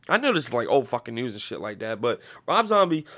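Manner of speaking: usual speaking voice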